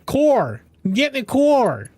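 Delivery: Core said with a full, loud American R. Shown as a demonstration. Shows chat how she says the R, loud and proud. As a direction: shout "Core" with a hard R. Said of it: "I say the R like or".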